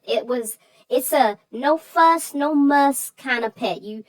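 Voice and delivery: ordinary delivery, nothing marked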